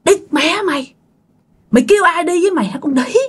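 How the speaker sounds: high, rapid scolding without shouting; angry cursing. A narrator's reading. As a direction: tear into someone as a furious young man. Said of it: "ảnh chửi vậy nè". (giọng chửi mắng giận dữ, dồn dập)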